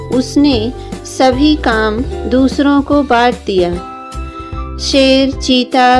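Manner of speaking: ordinary delivery, nothing marked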